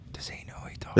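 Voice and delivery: hushed, breathy whisper. Retold or imitated. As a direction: whisper it like gossip. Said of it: "audiences will just look at me and go".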